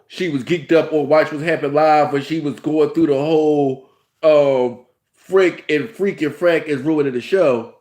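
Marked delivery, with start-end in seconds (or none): none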